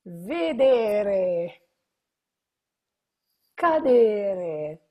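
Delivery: "Credere" and each verb called out loud and stressed; emphatic verb list. Shown as a demonstration. Teacher calling out the verb list loudly to the class. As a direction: call each infinitive out loud, slowly. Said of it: "first verb in our song".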